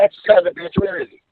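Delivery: a hint of an enraged girlfriend hunting him; retold furious woman. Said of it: "She said".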